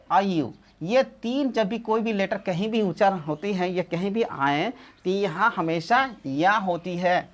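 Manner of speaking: ordinary delivery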